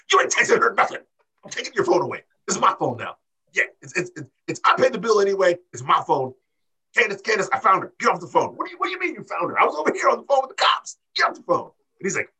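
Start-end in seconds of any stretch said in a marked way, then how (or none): 0.1-8.6 higher, fast bossy rant; mock bossy yell. Johnny taking the phone away, in a mock bossy yell. 8.6-11.7 much higher, very fast indignant screech; mock indignant screech. Candace snapping back at Johnny, in a high indignant screech.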